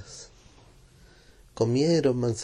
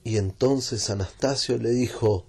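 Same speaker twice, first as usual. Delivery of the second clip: ordinary delivery, nothing marked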